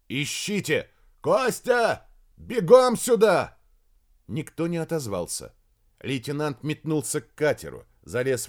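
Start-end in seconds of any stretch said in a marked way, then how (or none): 0.1-3.6 loud, high commands building in force; urgent shouting. A narrator's reading. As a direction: call out orders across the camp. Ordering search, calling missing man. (громкие приказы криком)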